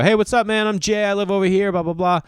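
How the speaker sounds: high, breezy hello to a new neighbor; imitated friendly small talk; neighborly greeting. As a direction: pitch it up, sociable and perfunctory.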